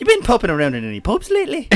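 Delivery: high, loud, fast mock Irish voice. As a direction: rattle it off like a hammed-up Irishman. Mock Irish pub banter.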